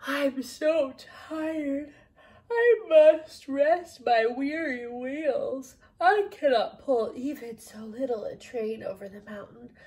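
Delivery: put-on slow, weary old engine voice, dragging each word; tired old engine. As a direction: sound worn out, like every wheel aches. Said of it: "I'm making myself tired. Just talking like that".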